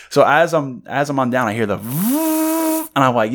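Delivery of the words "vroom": long, high drone whir; drawn-out high motor noise; imitated drone. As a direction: mimic a drone buzzing overhead.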